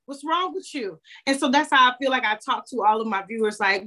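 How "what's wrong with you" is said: higher, doting grandmother; grandma Hazel voice. Retold or imitated. Grandmother checking on her grandchild, higher doting voice. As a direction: call them baby and beloved like a fussing grandma. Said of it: "And she used to always say".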